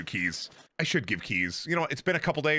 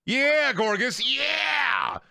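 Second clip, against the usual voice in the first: high, drawn-out whooping shout; excited cheer; 10 semitones higher, much slower than usual. Real, not acted. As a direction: cheer it on at full stretch. Cheering on an evolving Pokemon.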